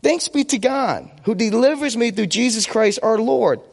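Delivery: ordinary delivery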